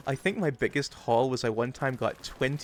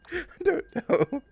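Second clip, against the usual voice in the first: laughing; 4 dB louder, slower than usual. Real, not acted. Laughing, still in voice.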